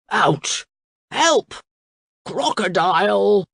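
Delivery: pained cries for help; crying out. A narrator's reading. King being chewed by a crocodile, crying out. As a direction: call out in separate alarmed bursts. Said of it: "cried the king".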